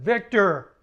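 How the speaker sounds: loud call across the room. Retold or imitated. Calling out to an old acquaintance across the room, loud. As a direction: shout his name to get his attention.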